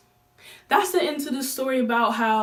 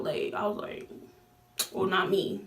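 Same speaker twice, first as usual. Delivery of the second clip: usual speaking voice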